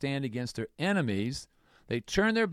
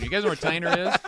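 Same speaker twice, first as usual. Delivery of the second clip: teasing; 6 semitones higher, 8 dB louder, faster than usual. Real, not acted. Teasing the group about the town.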